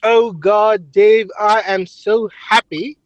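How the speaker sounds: half-convincing Englishman gushing about being happy; trying English accent. Acted. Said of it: "Well, you're trying".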